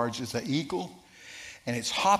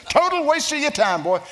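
even higher, loud brush-off; louder dismissal; 13 semitones higher, 9 dB louder than usual. Retold or imitated. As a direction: push the brush-off harder. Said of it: "That's what he said".

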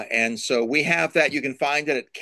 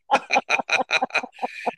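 laugh; 13 semitones higher than usual. Real, not acted. Laughing at own joke.

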